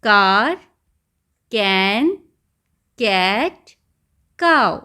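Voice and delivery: each word said loud and separate, with a pause after it; loud, slow phonics drill; phonics word reading. Shown as a demonstration. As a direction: call out each word clearly for the class. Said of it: "तो क वाला sound repeat हो रहा है, देखिए".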